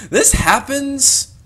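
slow, high, loud drawn-out announcement; dramatic. Acted.

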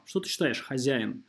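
normal talking voice

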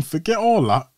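imitating the old man's rising anger at the ambulance; retold angry demand. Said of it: "he's getting mad at the ambulance as well".

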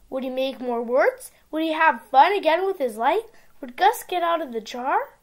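ordinary delivery

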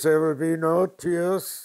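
loud, slow, high command; stern shout. Retold or imitated. Uncle shouts down the whimpering boy, loud and slow. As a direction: forbid the tears, loud and slow. Uncle forbids crying over wound.

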